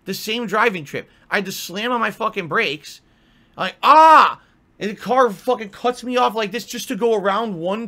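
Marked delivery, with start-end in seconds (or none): 3.6-4.4 a loud startled "ah!" at the swerving car; frustrated yell. Retold or imitated. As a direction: yell it out in alarm. Nearly hit by swerving car.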